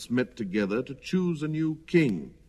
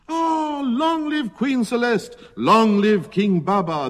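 high, loud crowd cheer; shouted acclamation; 12 semitones higher, 6 dB louder, slower than usual. A narrator's reading. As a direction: hail the new royals at full voice. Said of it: "shouted with one voice".

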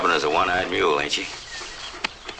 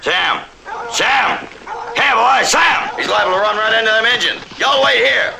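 loud, high urgent shouts; shouting; 6 dB louder than usual. Acted. Man calling his runaway dog, then ordering the others to wait, loud shouting. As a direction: yell after the dog, then bark orders.

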